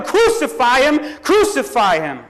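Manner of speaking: loud mob chant, starting high and falling; crowd shouting. A narrator's reading. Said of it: "they shouted".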